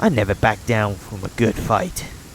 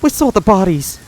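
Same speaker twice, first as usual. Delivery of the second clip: higher, tense widow-to-be; grim woman voice; 7 semitones higher than usual. Acted. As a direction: recall horror with raised voice. Svetlana grimly recalls.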